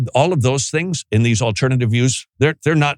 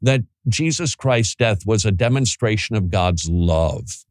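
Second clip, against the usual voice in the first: ordinary delivery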